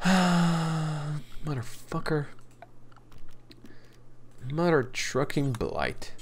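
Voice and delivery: slow, soft sighing curse; very slow soft muttered curse; defeated curse. Real, not acted. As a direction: deflate after a sigh. Blight ruins crops.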